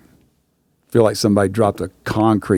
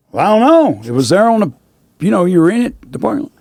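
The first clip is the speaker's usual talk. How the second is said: high puzzled Richie; 8 semitones higher, faster than usual. Retold or imitated.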